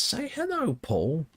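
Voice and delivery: high, far-off squeaky game character voice; parrot guy's greeting. Retold or imitated. Streamer imitating a game character's greeting. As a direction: mimic the game NPC's chirpy line. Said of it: "he says".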